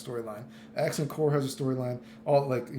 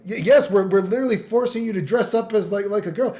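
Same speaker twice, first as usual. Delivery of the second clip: louder, higher exasperated answer; exasperated society voice; 5 semitones higher, 7 dB louder than usual. Pretend. Streamer voicing society insisting to Bridget, exasperated and louder. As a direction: sell the fed-up insistence. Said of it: "They're like".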